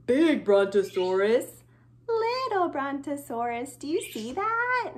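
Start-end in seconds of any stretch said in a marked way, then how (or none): 0.1-1.6 low, loud voice for a big dinosaur. Shown as a demonstration. Teacher showing children the big dinosaur, in a big voice. Contrasting big and little toys. 2.1-3.8 little voice. Shown as a demonstration. Teacher showing children the small dinosaur, in a little voice. Contrasting big and little toys.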